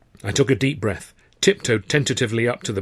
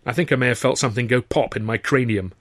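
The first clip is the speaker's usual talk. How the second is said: usual speaking voice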